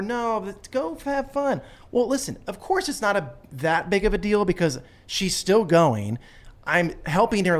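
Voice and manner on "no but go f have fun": mock forced cheer.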